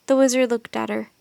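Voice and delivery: usual speaking voice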